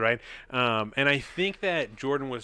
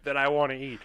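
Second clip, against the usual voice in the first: mock dramatic lament; 6 semitones higher, 11 dB louder than usual. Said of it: "I said".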